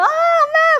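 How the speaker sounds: put-on child's high, excited cry of mom; child calling mom. Child runs toward mom, calling out excitedly. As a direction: call out to mom like a thrilled kid.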